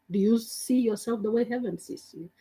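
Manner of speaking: ordinary delivery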